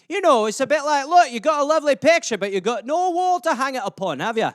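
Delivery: hammed-up Scottish lilt; Scottish accent. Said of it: "it's a Scottish euphemism".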